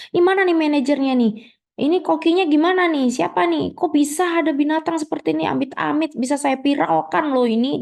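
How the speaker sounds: high, fast indignant ranting; an outraged customer at a restaurant; furious customer. Acted. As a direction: threaten to go viral, voice up high. Customer demands the manager.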